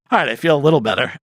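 fast, relieved; relieved quip. Retold or imitated. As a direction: rattle it off in relief. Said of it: "And I'm like".

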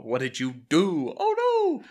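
a hint of horrified alarm, with a high yelp on oh; mock alarm. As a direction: play it lightly shocked, then squeal the oh. [name] horrified on phone.

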